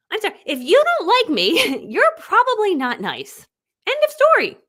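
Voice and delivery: loud, high, defiant statement with a laugh; emphatic declaration. Shown as a demonstration. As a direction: belt it out unapologetically, building to the end. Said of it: "That's how I say it".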